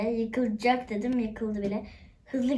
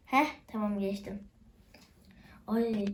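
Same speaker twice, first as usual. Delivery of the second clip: ordinary delivery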